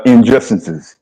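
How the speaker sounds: ordinary talk, nothing marked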